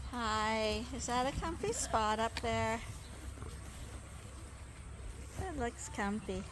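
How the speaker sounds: soft, hushed voice. Real, not acted. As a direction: speak softly so he keeps sleeping. Hushed near sleeping sheep.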